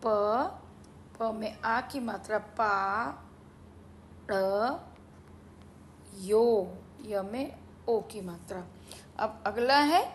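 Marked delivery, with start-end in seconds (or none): 0.1-8.6 sounding out words. Shown as a demonstration. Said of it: "पाढ़ियो कैसे लिखा जाएगा?".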